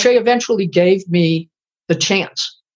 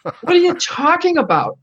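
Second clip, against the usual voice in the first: high, incredulous echo of a strange order; mock disbelief, pitched way up; baffled; 9 semitones higher than usual. Retold to the interviewer, a confused cook asking his boss.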